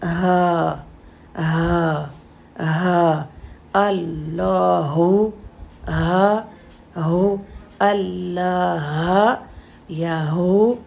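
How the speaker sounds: हा and अल्लाहु held slowly from low in the throat; deep round ha. Shown as a demonstration. Teacher reciting Allah to show the deep ha. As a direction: breathe अल्लाह out deep and slow. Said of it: "गले के बिल्कुल साथ".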